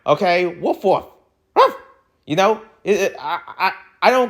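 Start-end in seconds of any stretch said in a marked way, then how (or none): 0.5-1.8 mock dog bark. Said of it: "I'm barking on the mic right now".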